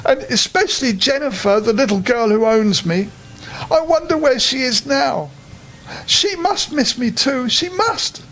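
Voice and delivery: a homesick little bear, a touch tearful; sad teddy bear. Acted. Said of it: "said Ernest sadly".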